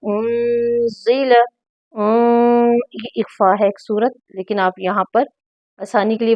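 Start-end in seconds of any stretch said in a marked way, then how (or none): 0.1-1.4 उनजिला with the noon lightly hidden, slow. Shown as a demonstration. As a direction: stretch उनजिला and barely sound the n. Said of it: "हल्का सा नून को छुपा लें".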